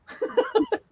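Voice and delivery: short bright laugh; burst of laughter. Real, not acted. Guest laughing at her own Jaden joke, a quick laugh.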